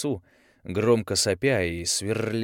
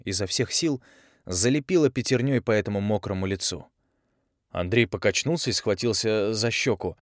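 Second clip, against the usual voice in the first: ordinary delivery, nothing marked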